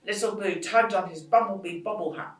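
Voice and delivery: ordinary delivery, nothing marked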